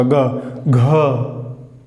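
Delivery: ग and घ said low with a buzzing vibration in the throat; voiced demo. Shown as a demonstration. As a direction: let ग and घ hum low in the throat. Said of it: "हल्का सा vibration होगा".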